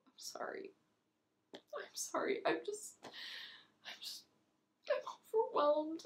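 very soft, breaking off; tearful apology. Acted. Apologizing to friends after snapping at one, tearful. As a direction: apologize in a faint, faltering near-whisper.

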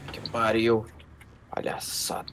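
breathy whispered scoff under the breath; whispered grumble. Acted. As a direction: hiss it to yourself in disgust. Commander grumbles alone after scene. (sussurro irritado para si mesmo)

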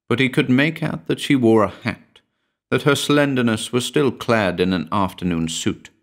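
ordinary delivery, nothing marked

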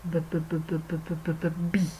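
"b" repeated softly as a sound, then "bee"; spelling out bee. Shown as a demonstration. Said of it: "we are going to make a".

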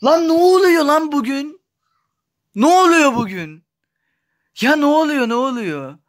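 high and loud, demanding what's going on; fed-up gamer shouting at the screen; frustrated yelling. Real, not acted. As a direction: yell at the game in disbelief. Frustrated at the game.

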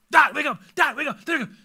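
put-on high, loud, insistent child calls; urgent repeated wake-up yell, mimicking kids; kids waking dad. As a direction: imitate excited children shouting a parent awake. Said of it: "when my kids come in and wake me like that".

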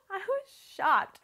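high, loud, drawn-out disbelief; shocked. Retold or imitated. Bride telling how the comment stunned her, shocked.

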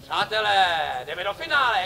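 slow, loud showman call; announcer voice. Acted. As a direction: build up the finale. Announces the finale to audience. (moderátorské ohlášení finále)

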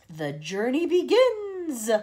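slow, loud, high proclamation; theatrical triumphant cry; grand cry. A narrator's reading. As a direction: announce it like a herald. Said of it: "he cried".

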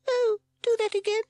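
very high, loud squeak; delighted chick. A narrator's reading. Admirer amused by Sid's clowning, loud squeak. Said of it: "said his newfound admirer".

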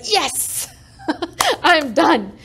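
picture the relief of finally being done and laugh it out; overjoyed laughing release; joyful laughing relief. Retold or imitated. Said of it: "I'll be so happy".

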